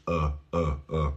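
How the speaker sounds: low, soft "uh"; repeated neutral "uh" vowel; relaxed short U. Shown as a demonstration. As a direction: say "uh" with a slack mouth. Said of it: "se relaja la boca".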